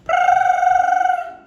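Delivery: very high, loud ringing buzz; alarm clock ring. A narrator's reading. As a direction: blast it like an alarm going off. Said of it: "went the alarm clock".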